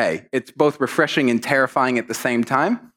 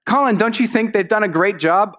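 lightly voiced parent, pitched higher; prompting parent; 7 semitones higher than usual. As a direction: fish for a compliment on their behalf. Parent prompts child for praise.

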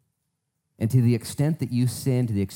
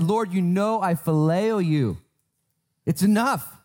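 higher, louder, exasperated answer; irritated reply; 8 semitones higher, 3 dB louder, slower than usual. Acted. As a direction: push back, fed up at the third asking. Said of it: "irritated at this point".

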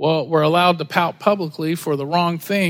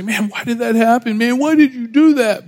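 higher-pitched imitation of a protest; mock complaining; 4 semitones higher, 3 dB louder than usual. Pastor mimicking a complaint stuntmen never make, mock protest. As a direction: put on a loud, high complaint.

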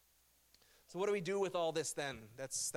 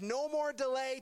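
God's declaration; 8 semitones higher than usual. Acted. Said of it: "when he says".

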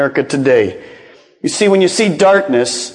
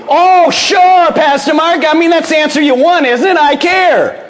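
high, louder sarcastic whine; mock congregant; 10 semitones higher, 3 dB louder than usual. Pastor mocking a congregant's easy excuse, sarcastic high whine. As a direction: mimic a congregant brushing off the pastor. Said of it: "the answer that I just got from you".

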